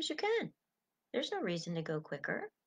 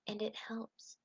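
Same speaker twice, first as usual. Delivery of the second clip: whisper; 9 dB quieter, slower than usual. Real, not acted. Tender aside about shy people.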